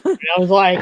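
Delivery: usual speaking voice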